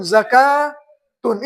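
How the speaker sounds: زکاتن drawn out slowly, za-kaa-tun; slow model reading. Shown as a demonstration. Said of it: "تا دو پیش تن".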